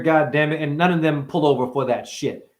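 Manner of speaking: ordinary talk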